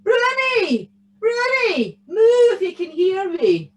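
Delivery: high, loud swooping call; shouting for Bruni. A narrator's reading. Tam searches for his stolen cow, shouting. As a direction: holler for a lost cow. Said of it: "then he shouted".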